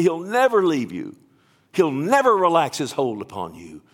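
loud, ringing declarations with punched key words; full-force preaching. Real, not acted. Preacher declaring God's promise to the congregation, full-force preaching.

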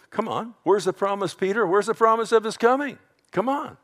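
high, taunting challenge; mocking. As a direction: needle Peter in a high scoffer's voice. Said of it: "In these mockers".

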